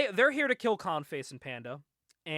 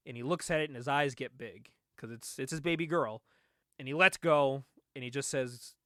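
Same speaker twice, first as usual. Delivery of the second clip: usual speaking voice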